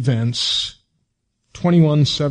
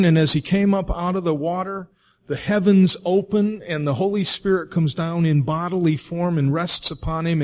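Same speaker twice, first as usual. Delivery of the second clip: ordinary delivery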